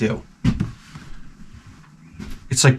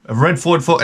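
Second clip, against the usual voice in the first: higher-pitched call-out to the room; coworker asking around; 7 semitones higher than usual. Retold or imitated. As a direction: lightly imitate someone asking around a warehouse. Imitates staff asking around the warehouse.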